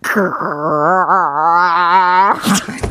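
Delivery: long rising scrape made with the mouth; chair scraping sound. Shown as a demonstration. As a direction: scrape it like a chair dragged across the floor. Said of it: "의자 끄는 소리 들려줘요".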